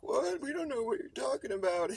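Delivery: high, loud fake-innocent denial; grandpa playing innocent. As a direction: play dumb like a caught old man. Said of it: "and were like".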